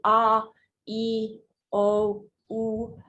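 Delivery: A, E, O, U as drawn-out pure vowel sounds, one by one; isolated vowel sounds A, E, O, U, teacher demo. Shown as a demonstration. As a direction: sound out A as "ah", U as "oo", with gaps between. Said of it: "sounds a little bit different than the actual letter".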